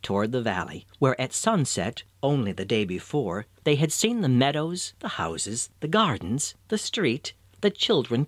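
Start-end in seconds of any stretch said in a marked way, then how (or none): none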